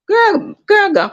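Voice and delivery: high-pitched, campy imitation of her; mock diva singer. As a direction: mimic her showing off, far above your own voice. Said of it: "be acting like you [name]".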